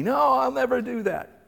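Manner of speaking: high, firm protest; insistent denial. Acted. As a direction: refuse to believe it. Peter protests to Jesus.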